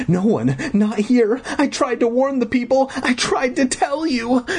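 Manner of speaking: put-on high, escalating little-girl plea; sobbing protest. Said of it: "cut off again by her loud sobs".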